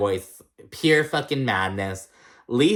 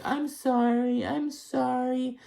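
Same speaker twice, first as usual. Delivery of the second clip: mock-meek apology pitched way up high and slow; meek kids; 14 semitones higher, 3 dB quieter, much slower than usual. Imitating the bad classmates apologising to the crying teacher. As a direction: squeak out a sheepish sorry like scolded kids. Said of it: "kids were like".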